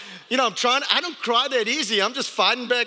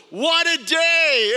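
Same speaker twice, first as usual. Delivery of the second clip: savoring; 8 semitones higher, 5 dB louder, much slower than usual. Acted. Savouring the memory.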